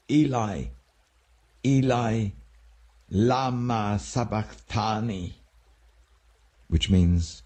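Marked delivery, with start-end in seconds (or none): 0.1-5.4 a high, slow, drawn-out cry of Eli; loud cry. A narrator's reading. Jesus on the cross cries out to God, high and slow. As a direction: stretch each Eli upward, calling to heaven. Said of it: "Jesus cried out in a loud voice".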